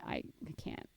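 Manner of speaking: breathy whispered despair. Retold or imitated. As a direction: whisper it, defeated. Overwhelmed by algorithm feed.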